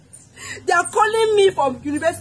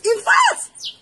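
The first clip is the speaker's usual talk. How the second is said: sudden shout; 10 semitones higher than usual. Acted. Angry wife scolding caller.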